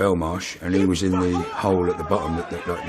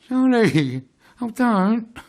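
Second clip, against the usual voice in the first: high-pitched mock pleading; grovelling; 14 semitones higher, much slower than usual. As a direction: beg meekly in a put-on high voice. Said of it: "and, uh, grovelled like".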